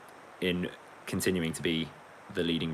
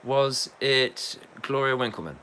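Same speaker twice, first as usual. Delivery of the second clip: pretend quiz contestant; 6 semitones higher than usual. Pretend contestant guesses slowly.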